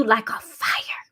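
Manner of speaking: soft breathy whisper of a prayer. Acted. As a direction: drop to a hush on fire.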